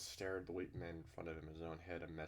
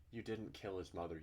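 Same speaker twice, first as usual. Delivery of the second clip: ordinary delivery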